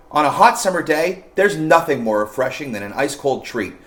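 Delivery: normal talking voice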